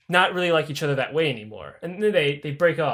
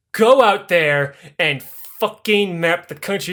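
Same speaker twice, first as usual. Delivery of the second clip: slow commanding order; 3 dB louder, slower than usual. Retold or imitated. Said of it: "just hands the map".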